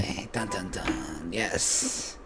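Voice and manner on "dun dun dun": low, soft mock suspense sting "dun, dun, dun"; dramatic sting. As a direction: hum the ominous cliffhanger music. Dramatic cut-away after cliffhanger.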